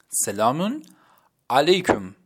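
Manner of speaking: سلامون علیکم slowly, word by word; Turkish selamun aleykum. Shown as a demonstration. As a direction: separate سلامون and علیکم as a model.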